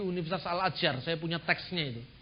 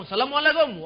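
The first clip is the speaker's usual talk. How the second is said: called greeting; 6 semitones higher, 6 dB louder than usual. Acted. Acted greeting exchange.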